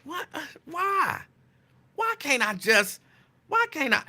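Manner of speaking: high-pitched, baffled whine of protest; exasperated protest. Retold or imitated. Host replies to Lincoln's suspicion, in exasperated protest. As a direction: retell it as stuttering, high-pitched disbelief. Acting out his exasperated reply.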